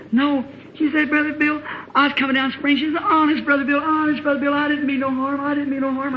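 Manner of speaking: high tearful pleading. Retold or imitated. Crying girl pleading with the preacher, high and tearful. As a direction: beg him to believe you, near tears.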